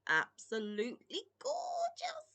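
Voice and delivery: squealing high with delight; gushing high. Real, not acted. Gushing over cute gnomes.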